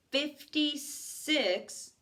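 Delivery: "fifty-six" said high and very slowly; drawn-out emphasis. Shown as a demonstration. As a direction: stretch out "fifty-six" for effect. Teacher dwells on the score.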